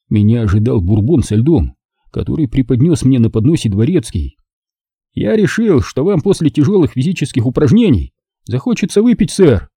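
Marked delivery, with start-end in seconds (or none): 5.1-9.7 higher-voiced butler, dry and straight-faced; deadpan butler. A narrator's reading. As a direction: serve the line without a trace of a smile. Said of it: "без тени улыбки произнёс он".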